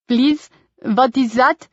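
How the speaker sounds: put-on slow, careful German tourist accent; a foreign visitor asking in a thick German accent; heavy Germanic accent. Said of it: "with a heavy Germanic accent".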